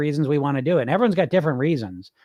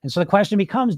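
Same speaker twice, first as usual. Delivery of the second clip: usual speaking voice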